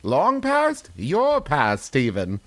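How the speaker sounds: spooky upscale British woman, Michael Caine style; Michael Caine British accent. Acted. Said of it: "doing Michael Caine as the British woman".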